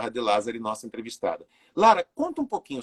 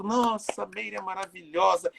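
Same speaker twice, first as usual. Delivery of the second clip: gushing admirer; 6 semitones higher than usual. Retold or imitated. Voicing admiring neighbours who greet her.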